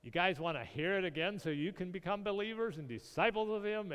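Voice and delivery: imitating a fed-up man's sarcastic comeback; exasperated retort. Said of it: "finally he's exasperated".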